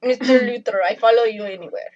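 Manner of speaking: put-on quick Russian accent, devoted; a Russian villainess accent. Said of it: "That's a pretty good Russian accent".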